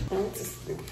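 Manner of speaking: normal talking voice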